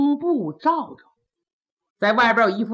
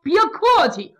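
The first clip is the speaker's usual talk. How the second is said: put-on higher, quick, chummy old minister's voice; a breezy old official pushing to see the coffin, pitched up; higher, hearty old official; Kou Zhun, jovial; 8 semitones higher, faster than usual. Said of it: "寇准说".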